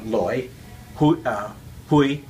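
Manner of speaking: "loi", "hui" in Cantonese sounds. Shown as a demonstration. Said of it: "my home dialect is Cantonese".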